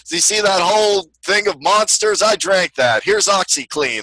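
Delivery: hyped-up, high-pitched pitchman; Billy Mays. Acted. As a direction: shout it like a manic TV salesman. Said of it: "I'm so excited".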